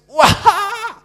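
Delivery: a sudden high, loud whooping laugh; loud laugh burst. Shown as a demonstration. Preacher demonstrating laughing at a stupid joke, loud high whoop. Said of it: "Then laugh ... just".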